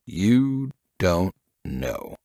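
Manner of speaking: slow, deliberate, a pause after each word; pausing between words. A narrator's reading. Old investigator pressing the young agent, slow with pauses between words. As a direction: let each word land alone. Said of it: "paused a little between each word as he spoke".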